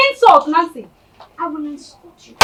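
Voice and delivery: usual speaking voice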